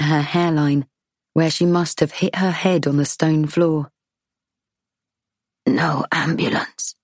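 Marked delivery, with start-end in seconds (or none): 5.7-6.9 slow breathless whisper; trembling whisper. A narrator's reading. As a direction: whisper it, hurt and weak. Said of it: "she whispers".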